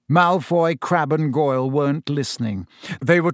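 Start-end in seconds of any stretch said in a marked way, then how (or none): none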